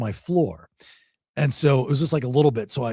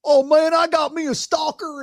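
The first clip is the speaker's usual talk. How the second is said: imitating a coworker bragging, much higher; mock bragging coworker; 13 semitones higher than usual. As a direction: ham up a coworker showing off. Coworker bragging about stalker.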